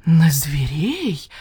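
breathless, slow horrified whisper. A narrator's reading. The fox, horrified at the charge, whispers slowly. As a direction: gasp the words out in shock. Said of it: "ужаснулась лиса".

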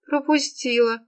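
high, sniffly reply; tearful. A narrator's reading. Serafima still shaken by sobs, tearful. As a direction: answer in a high, tearful whine. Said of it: "всё ещё содрогаясь от всхлипов".

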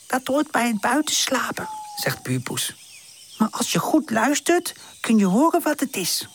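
a hint of a sure-of-herself cat. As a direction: neighbour cat explaining the night noises, lightly voiced. Narrator voices neighbour cat.

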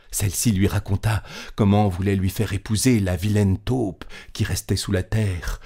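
usual speaking voice

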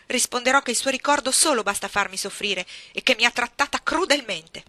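più forte, la voce che trema di rabbia; risentimento furioso. A narrator's reading. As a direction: sputale addosso tutto il rancore. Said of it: "in preda a un incontenibile risentimento". (bambina ribelle contro la zia, rabbia forte)

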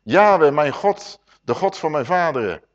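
loud opening name, then measured reading; solemn scripture reading. Acted. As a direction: proclaim the text like a preacher. Opens with a solemn scripture read.

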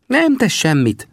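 magas, elnyújtott figurahang; high, drawn-out consoling character voice; vigasztaló Sam. A narrator's reading. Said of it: "vigasztalta Sam". (magas hangon, lassan vigasztalva)

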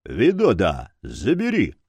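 curt voiced order; mildly commanding tone. A narrator's reading. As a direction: give an order to an underling. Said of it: "после чего скомандовал".

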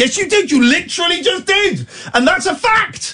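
high-pitched insistent retort. Real, not acted. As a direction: jab back, voice way up. Host insists in argument.

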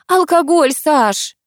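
ordinary delivery, nothing marked

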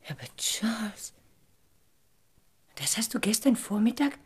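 tonloses, leises Flüstern; gerührt geflüstert. Acted. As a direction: ganz leise und ergriffen flüstern. Valerie liest gerührt den Brief. (geflüsterte Frage an Charles nach dem Brief)

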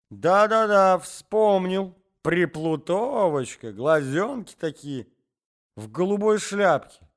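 put-on a garrulous old man starting a tale; old man's voice.

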